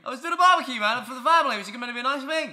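high, pleading mock teen. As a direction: squeal the excuse, overacted. Mock excuse continues.

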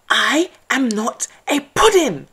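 high, loud furious shout; angry shout. A narrator's reading. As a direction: yell back at the wolf. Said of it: "shouted Mouse angrily".

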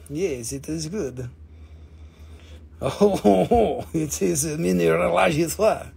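hammed-up French accent with a chuckle. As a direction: put on a playful French accent. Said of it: "I get my French accent".

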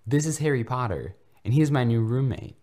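high, loud and proud; joyous. A narrator's reading. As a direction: show off your new friend happily. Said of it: "screeched joyously".

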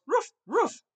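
two high, loud mock woofs; playful "roof, roof" yaps; dog bark. Shown as a demonstration. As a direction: bark twice like a dog for the class. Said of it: "we're gonna say roof".

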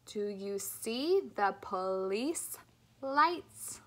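"love life" with slow, clear L; slow, careful speech-therapy model; slow clear L drill. Shown as a demonstration. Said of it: "You ready?".

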